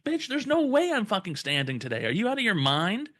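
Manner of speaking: high-pitched indignant protest; retold incredulous refusal. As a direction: act out outraged disbelief.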